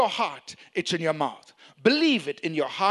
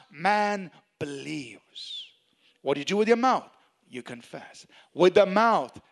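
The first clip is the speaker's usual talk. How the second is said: ordinary talk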